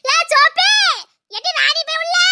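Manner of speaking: high loud call of the name. Acted. Old woman calling Soppi out of the house. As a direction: call her name sharply.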